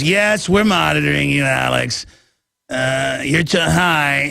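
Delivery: slow, lightly put-on spy voice; a hint of a mysterious spy, drawn out; imitating the spy. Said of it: "And he talks like that".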